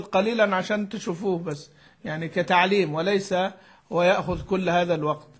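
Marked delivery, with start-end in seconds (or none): none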